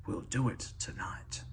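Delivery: soft conspiratorial whisper. A narrator's reading. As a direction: breathe it without voice. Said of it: "He whispered something".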